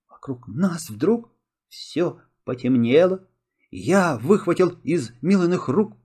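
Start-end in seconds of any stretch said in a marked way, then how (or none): none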